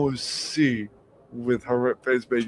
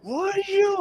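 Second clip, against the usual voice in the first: very high mock-angry squeal; imitated outburst, pitched way up; angry girlfriend. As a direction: squeak it like a furious little girlfriend. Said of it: "She'd get angry at me".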